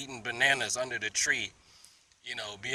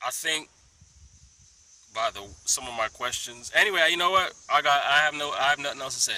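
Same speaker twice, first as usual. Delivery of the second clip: usual speaking voice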